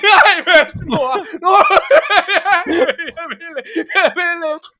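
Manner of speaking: high, loud, sobbing plea broken by laughter; boy refusing grandmother, hammed-up sobbing; mock crying boy. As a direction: wail like a terrified little kid. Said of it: "jeg skreg og græd samtidig".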